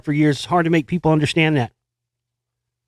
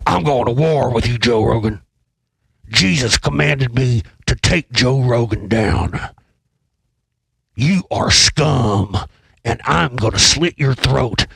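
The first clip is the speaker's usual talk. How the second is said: slow, menacing conspiracy-ranter voice; Jones impersonation; 4 semitones lower, slower than usual. Pretend. Podcaster mocking Jones threatening Joe Rogan, slow menacing rant. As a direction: drag out the threats like a ranting radio host. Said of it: "does my Jones impersonation".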